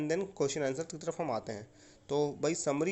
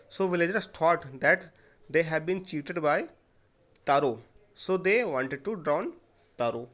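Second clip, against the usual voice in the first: slow, louder answer dictated phrase by phrase; slow dictation; 5 dB louder, much slower than usual. Shown as a demonstration. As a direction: dictate slowly and clearly for the class to copy. (धीमे और ज़ोर से लिखवाते हुए)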